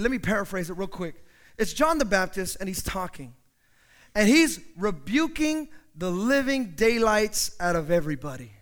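usual speaking voice

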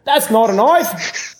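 slow, hammed-up Australian accent; mock Australian accent. Callers sign off to hosts, slow hammed-up Australian accent. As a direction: drag out a bad Aussie voice for the sign-off. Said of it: "Australian accents".

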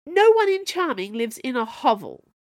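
high, loud furious retort. Acted. Camilla defending her kingdom, furious. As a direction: snap back furious.

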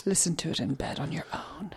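breathy suggestive whisper. Acted. Guest teasing listeners in a whisper. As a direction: hush it like a naughty secret.

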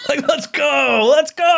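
high, hyped fan cheer with laughter; fired up. Retold or imitated. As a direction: cheer it on, drawing out the words. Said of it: "I'm, like, all fired up".

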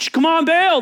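high, loud frantic call. Acted. Prophets begging Baal for fire, loud high cry. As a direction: cry out to a silent god.

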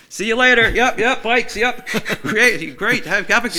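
high, nervous laughing goodbye; awkward laughing exit. Retold or imitated. Backing away from the shouting biker, laughing nervously. Had to fucking run from him.

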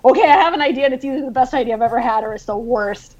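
high start, tumbling down into softness; retold excited pitch. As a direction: lightly re-enact an eager, hurried idea pitch. Retells her excited call to editor.

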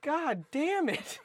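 high, slow, drawn-out curse; exasperated curse. Real, not acted. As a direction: stretch it out in comic frustration. Exasperated at being derailed.

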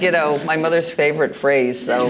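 normal talking voice

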